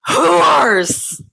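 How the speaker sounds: high-pitched mock outrage; scandalized pretend condemnation; mock disgust. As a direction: spit it out in hammed-up revulsion. Mock disgust at the frat boys.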